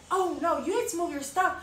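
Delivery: retold angry demand to move; imitating angry mother. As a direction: bark the order like an irate mom. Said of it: "She was, like, cursing at me".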